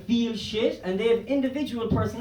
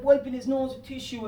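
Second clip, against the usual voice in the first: usual speaking voice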